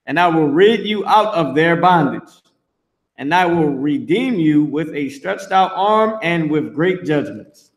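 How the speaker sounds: measured, formal Bible reading; scripture reading. Acted. Preacher reading Exodus aloud to his listeners, solemn. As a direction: read the verse out with weight.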